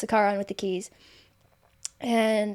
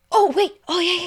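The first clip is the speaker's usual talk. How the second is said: high, louder oh wait; sudden recall; 9 semitones higher, 4 dB louder than usual. Real, not acted. Storyteller realizes she skipped a part, surprised. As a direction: catch yourself mid-story.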